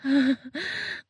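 short breathy laugh; pleased laugh. Pretend. Performer pleased with a partner, short laugh.

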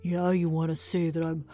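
loud, swaggering tailor taunt; mock boast. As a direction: brag about it to the giant's face. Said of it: "be like".

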